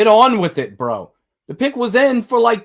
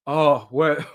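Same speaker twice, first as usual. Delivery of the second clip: ordinary talk, nothing marked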